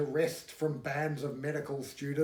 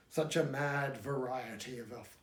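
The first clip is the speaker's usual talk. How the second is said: ordinary delivery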